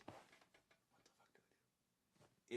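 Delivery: hushed whisper of an inner thought. Retold or imitated. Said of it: "in my head, I'm like".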